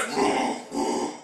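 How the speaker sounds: soft whooshing engine noise; imitated vroom. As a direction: breathe out low-volume vrooms. Imitates the ship or lightsaber noise.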